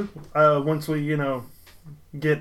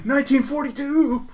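put-on much higher eerie child ghost; 9 semitones higher than usual. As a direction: answer as a spooky little ghost. Voicing a ghost answer.

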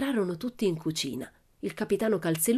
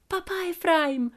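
high, drawn-out greeting to papa; delighted daughter hailing her father; joyful cry; 11 semitones higher, slower than usual. A narrator's reading. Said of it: "gridò Pippi lì appesa al suo collo".

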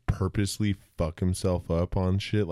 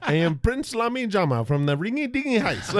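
very high, pompous character voice; Master of Disguise voice; 13 semitones higher than usual. Retold or imitated. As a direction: announce yourself like a silly prince. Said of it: "he's like".

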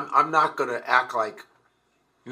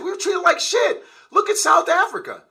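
ordinary talk, nothing marked

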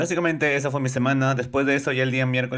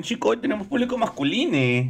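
playful; 9 semitones higher than usual. Pretend. Playful mock gendered word.

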